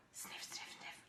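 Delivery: breathy dog sniffs; whispered sniffing. Acted. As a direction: sniff softly under your breath.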